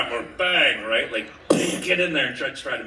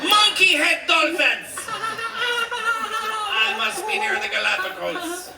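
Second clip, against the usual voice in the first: silly storyteller; 13 semitones higher, slower than usual. Acted. Absurd story voice.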